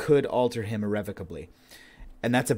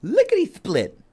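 loud, very high lisping beaver; lisping Barry Beaver; 8 dB louder than usual. Acted. Beaver correcting his slip, high loud lisping voice. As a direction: squeal it with a buck-toothed lisp. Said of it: "I'm sorry, I have a lisp".

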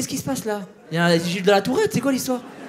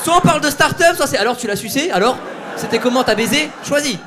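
higher, louder, half-laughing fury; mock furious ultimatum; 5 semitones higher, 5 dB louder than usual. Comedian gives heckler ultimatum, mock furious shout. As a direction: rant the choice at him.

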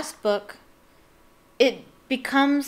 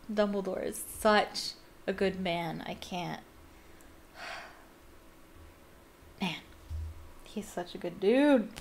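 sighing fondly; 4 dB quieter than usual. Real, not acted. Reader sighs admiringly.

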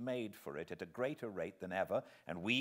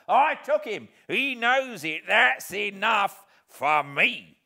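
gruff insistence; 12 semitones higher, 13 dB louder, slower than usual. Acted. Convict repeats his claim.

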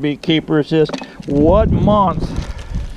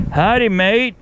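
put-on a playful Australian-accented "Howdy, mate" with a raised, rising "Howdy"; mock Australian greeting; 6 semitones higher than usual. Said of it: "All my Australian beekeepers".

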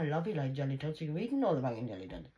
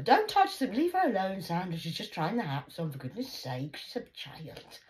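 mother snapping at grandma over the hats; mother scolding; 2 semitones higher, 4 dB louder than usual. Retold or imitated. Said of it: "So my mother".

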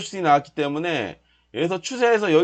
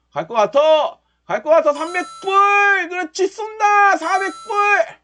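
building to ecstatic cheers; 9 semitones higher, slower than usual. Real, not acted. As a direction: count up the profit in rising thrill. Profits climbing, building excitement.

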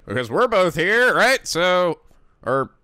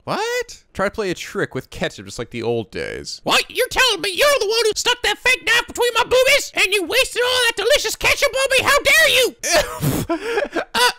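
put-on shrill angry girl; 14 semitones higher than usual.

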